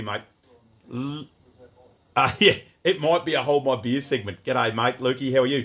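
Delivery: normal talking voice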